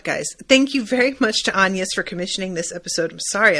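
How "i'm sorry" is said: ordinary talk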